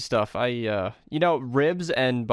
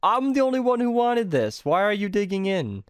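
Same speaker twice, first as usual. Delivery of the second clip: exasperated quote; 8 semitones higher than usual. Retold or imitated. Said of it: "I'm like".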